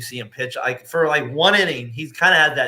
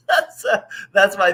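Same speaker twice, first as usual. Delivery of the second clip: high squeaky burst of laughter; laughing at the bee guy story; squealing laugh; 7 semitones higher, 6 dB louder than usual. Real, not acted. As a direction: crack up helplessly at your own story. Cracking up over the story.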